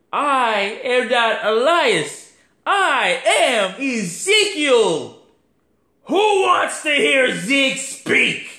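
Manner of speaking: high, slow, put-on Ezekiel voice; mock Ezekiel. Mimicking Ezekiel's reveal to the crowd, high and slow. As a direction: ham up the wrestler's comeback line. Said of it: "And I'm like".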